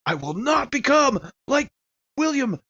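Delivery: high, slow put-on voice; drawn-out, high character vow; defiant vow. Character refusing his fate, high and slow.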